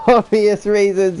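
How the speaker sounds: reads it through real laughter; laughing while reading. Real, not acted. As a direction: laugh your way through the line. Reading chat message, laughing.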